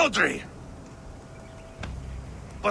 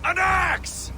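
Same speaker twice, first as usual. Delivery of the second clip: desperate shout; 10 semitones higher than usual. Acted. Demanding axe for dying boy.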